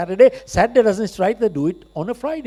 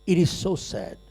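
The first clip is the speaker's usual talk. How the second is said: softer, sorrowful repeat; soft sad echo; 6 dB quieter than usual. Acted. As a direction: let it fall away in sorrow. Same words, said again softly. (धीमी, उदास गूँज)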